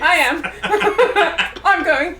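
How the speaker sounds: laughing. Real, not acted. Player laughing at clown bit.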